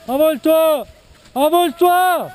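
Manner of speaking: calling out. Real, not acted. Cheering a skier to take off.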